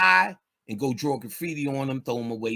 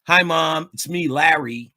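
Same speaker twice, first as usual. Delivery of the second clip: higher, louder, sweet and proper; polite son; 4 semitones higher, 6 dB louder than usual. Retold or imitated. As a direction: play the good boy home to mom. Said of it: "talking to your mama like that".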